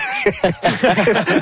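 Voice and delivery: short high nervous giggle; nervous laugh. Real, not acted. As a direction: laugh it off, a bit embarrassed. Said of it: "c'est un vrai nerveux". (petit rire nerveux)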